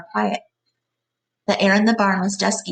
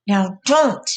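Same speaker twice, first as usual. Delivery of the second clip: angry; 4 dB louder than usual. A narrator's reading. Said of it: "Then Lenny grew angry".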